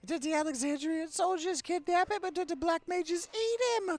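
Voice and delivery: high, loud frantic squeak; panicked character. Acted. Streamer voices Moogle's letter, high frantic squeal. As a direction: squeal it like a panicked little critter.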